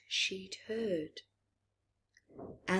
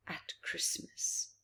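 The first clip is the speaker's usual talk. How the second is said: whisper; 10 dB quieter than usual. Acted. Spooky whispered book title.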